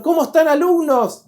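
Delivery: high, loud call to the whole class; loud class greeting. Retold or imitated. A student teacher greets his class from the doorway, loud and high. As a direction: hail the students as you walk in.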